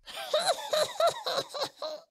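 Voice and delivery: high, falling impish cackle; high tricky goblin giggle; goblin laugh. A narrator's reading. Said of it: "laughed the goblin".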